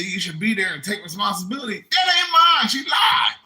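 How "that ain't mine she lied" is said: high, loud indignant shout; protesting guest. Retold or imitated. Tyrone denying the baby, high loud shout.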